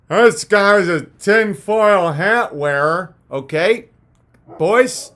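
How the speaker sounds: drawn-out sneering critic; very slow mocking sneer. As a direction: stretch out every word in scorn.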